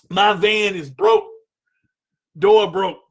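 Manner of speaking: normal talking voice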